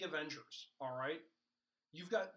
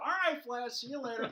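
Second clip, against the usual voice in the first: higher, louder, pally goodbye; villain cheerily seeing off his captor; chummy trickster; 9 semitones higher, 9 dB louder than usual. Retold or imitated. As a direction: wave him off like an old drinking buddy. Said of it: "He's like".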